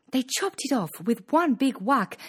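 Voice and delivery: ordinary delivery, nothing marked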